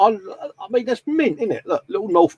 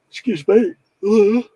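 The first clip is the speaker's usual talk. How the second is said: talking through a yawn; words stretched by a yawn; 7 semitones higher than usual. Real, not acted. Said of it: "Apologies for my yawning".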